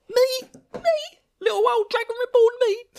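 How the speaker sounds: very high, loud faux-modest squeak; mock innocent Rand. Rand playing innocent before the Amyrlin, high mock squeak. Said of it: "kneel and say".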